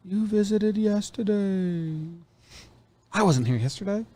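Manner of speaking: high, slow spooky ghost; drawn-out eerie ghost. Pretend. Ghost voice dialogue.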